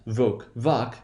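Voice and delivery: "voc, voc" as "voke", then "vahk"; long then short, both stressed. Shown as a demonstration. Teacher showing same stress, changed vowel, long then short. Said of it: "they're both stressed".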